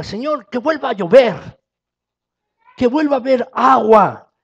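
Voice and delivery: loud, high fervent plea to heaven; fervent prayer. Acted. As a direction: cry out the prayer for rain. Elijah's prayer voiced loudly.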